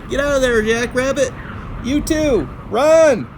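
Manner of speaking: high, loud cheering at the picture; excited calling out. Real, not acted. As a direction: shout to the animals to run. Cheering the animals fleeing.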